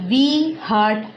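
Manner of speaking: each word spaced out slowly; slow classroom demo sentence; slow, clear example. Shown as a demonstration. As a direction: say it slowly like a teacher's model sentence. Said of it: "we is a plural".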